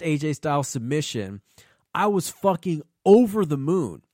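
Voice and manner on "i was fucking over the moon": ordinary delivery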